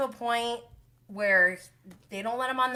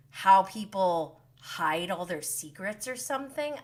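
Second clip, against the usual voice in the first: ordinary delivery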